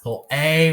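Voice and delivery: "Pull, a" with the long "a" punched; loud, high "pl-AY", split before the vowel; last sound stressed. Shown as a demonstration. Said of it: "What do we hear last?".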